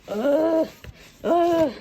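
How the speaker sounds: loud, high "ugh" of exasperation; two sharp bursts of real annoyance; frustrated groan. Real, not acted. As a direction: groan loudly, freaked out by fumbling. Frustrated handling items one-handed.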